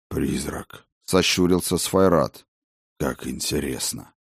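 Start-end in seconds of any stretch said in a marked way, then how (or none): 0.1-0.9 low dragon voice. Acted. Dragon squints. 3.0-4.1 lower, quiet, dry dragon; a wry dragon, half to himself. Acted. Dragon remarks.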